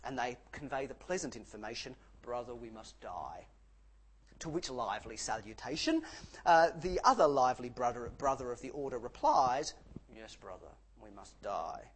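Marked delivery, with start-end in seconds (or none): 2.2-3.5 graveyard tone. Retold or imitated. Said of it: "in graveyard tones". 10.2-11.9 hushed, mock sepulchral monk; softer mock graveyard reply, monk to monk. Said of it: "in sepulchral tones, in graveyard tones".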